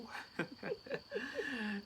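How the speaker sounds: high rueful laugh; embarrassed laugh. Real, not acted. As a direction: laugh at yourself, a bit sheepish. Laughs at renovation delay.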